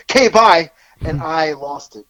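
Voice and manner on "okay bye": imitating a breezy sign-off, higher and louder; mock-cheerful okay bye; quick cheery sign-off. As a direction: toss it off brightly, imitating her. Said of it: "so she just ends it with".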